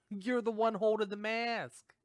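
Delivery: high, exaggerated disbelief; playfully indignant high voice; mock incredulity. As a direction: point out the absurdity, pitched right up. Mock-challenging the man with the mask.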